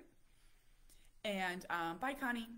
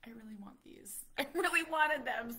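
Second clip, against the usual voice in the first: laughing whisper; 7 semitones higher, 10 dB louder than usual. Retold or imitated. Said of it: "I was like".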